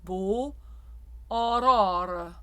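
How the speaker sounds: beau closed, aurore open. Shown as a demonstration. Coach contrasting closed and open O for singers. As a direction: aurore with the back jaw dropped. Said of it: "The back jaw is a little more closed".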